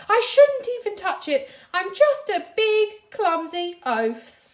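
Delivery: high, loud, slowing lament; self-pitying wail. A narrator's reading. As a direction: wail about being clumsy. Patrick self-pitying outburst.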